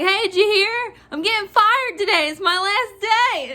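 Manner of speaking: high, playful sing-out; mock excited announcement. Clerk greets managers joking she's fired, mock excited. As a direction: announce it as gleeful big news.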